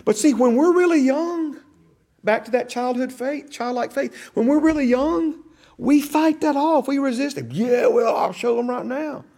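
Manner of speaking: high, loud preaching, driving every line; preaching at full force. Acted. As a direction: preach it hard to the congregation.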